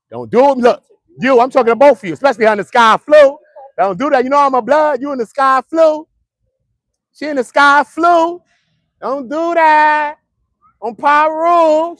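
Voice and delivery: high, loud comic pleading; loud mock warning. Host warning both of them off, high loud mock warning. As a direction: call it out high, stretching the last words.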